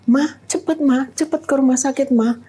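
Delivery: high-pitched, urgent retold phone call; urgent plea.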